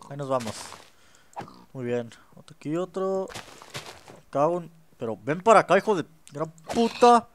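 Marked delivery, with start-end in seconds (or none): 5.2-7.3 angry curse. Real, not acted. Cursing at attacking mob.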